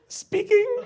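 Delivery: very high, loud answer; hammed-up high telephone voice; high-pitched phone reply. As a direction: squeal it as a one-word reply.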